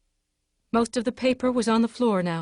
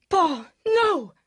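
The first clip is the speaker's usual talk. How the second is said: high, strained cry of horror; anguished scream; 9 semitones higher than usual. A narrator's reading. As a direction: cry out in agony as she understands. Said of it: "she screamed".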